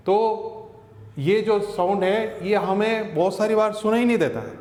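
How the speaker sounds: ordinary delivery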